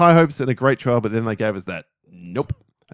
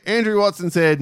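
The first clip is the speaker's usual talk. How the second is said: ordinary talk, nothing marked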